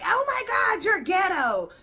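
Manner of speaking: high, loud shocked mock; mocking the customer. Vlogger imitating the shocked customer, high and loud. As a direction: squeal it like an offended customer. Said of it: "she's like".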